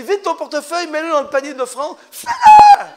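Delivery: building to a huge laughing shout; joking Holy Spirit command. Pretend. Preacher jokingly voicing the Spirit's command about the offering, ending in a laughing shout. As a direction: push the command up into a hammed-up yell. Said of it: "Le Saint-Esprit dit".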